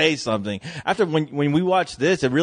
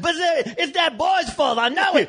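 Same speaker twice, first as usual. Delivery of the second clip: mock daddy grumbling. Host voicing a grumpy father blaming the boy. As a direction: whine the blame through a laugh. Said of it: "I said".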